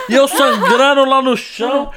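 ordinary delivery

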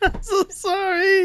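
high, slow, drawn-out laughing sorry; exaggerated mock sorry; mock apology. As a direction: stretch the apology out mockingly. Mimicking husband's apology.

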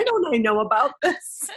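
high, laughing mock whine; comic cry of feeling left out; mock wail. Outsider mom reacting to hooked-up mommies, mock wailing.

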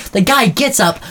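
normal talking voice

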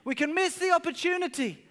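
high, loud, impassioned preaching; preaching at full force. Acted. Pastor urging the church not to miss Jesus, full-force preaching. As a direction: drive the point home to the congregation.

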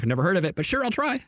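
squeaky high-pitched shrug; high mock reply. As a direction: pitch up sharply on I'll try. Retold own reply.

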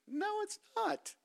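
high, soft, drawn-out mock protest; a petulant no from the disciples. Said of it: "They're like".

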